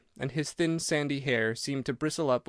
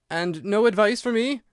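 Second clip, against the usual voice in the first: higher and louder, a desperate plea with the voice cracking; breaking voice; 8 semitones higher, 5 dB louder than usual. A narrator's reading. Abandoned patient begs Holmes for advice, voice breaking. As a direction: cry out as if about to break down. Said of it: "in a breaking voice".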